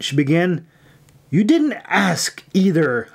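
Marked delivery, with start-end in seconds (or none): 1.3-3.1 mildly angry, higher-pitched comeback; snapped retort. A narrator's reading. Irene rebukes May for not asking, higher-pitched snap. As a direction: snap back with raised pitch. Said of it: "Irene snapped".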